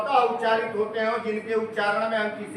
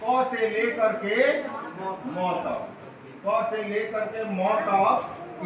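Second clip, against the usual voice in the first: slow dictation; much slower than usual. Shown as a demonstration. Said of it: "slow dictation to class".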